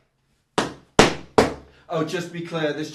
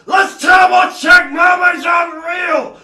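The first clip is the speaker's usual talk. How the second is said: very loud, high, stretched-out yell; exasperated shout; 14 semitones higher, 16 dB louder, slower than usual. Real, not acted. Comic rebutting online mermaid complainers, exasperated shout. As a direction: yell it slowly word by word. Rant against remake complainers.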